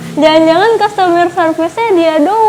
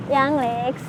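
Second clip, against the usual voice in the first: ordinary talk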